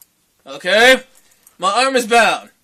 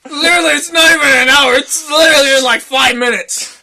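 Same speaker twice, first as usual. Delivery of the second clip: loud, higher-pitched incredulous objection; exasperated protest; 5 semitones higher, 6 dB louder than usual. Retold or imitated. Boyfriend arguing with clingy girlfriend over five minutes, exasperated protest. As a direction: argue back loudly, dragging out the words.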